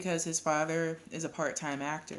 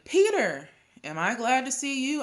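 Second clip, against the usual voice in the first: loud, high burst of relief; relieved greeting; 8 semitones higher, 6 dB louder than usual. A narrator's reading. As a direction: greet your son like a rescuer. Mother greets son with relief.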